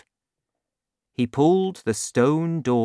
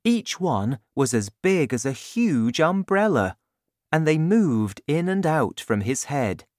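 plain reading voice

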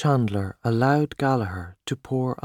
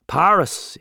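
Paris said out loud and higher after the whisper; louder Paris; 6 semitones higher, 6 dB louder than usual. Acted. Gallagher urging Tommy to go to Paris, out loud. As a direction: declare the word Paris firmly.

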